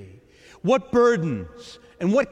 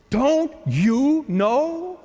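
higher, slow, drawn-out disbelief; incredulous mock; 6 semitones higher, much slower than usual. Echoing the disciples' complaint.

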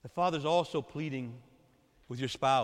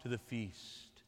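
slow, softer and lower invitation; soft plea; 6 semitones lower, 6 dB quieter, much slower than usual. Acted. Father pleading with a drifted child, soft and slow. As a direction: invite tenderly, almost a hush. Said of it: "the Father's pleading".

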